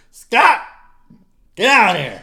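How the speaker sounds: loud, high holler across the house; hammed-up shouted order; mock yell. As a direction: bellow it like a bossy hunter home with the kill. Acts Kelsey yelling at husband.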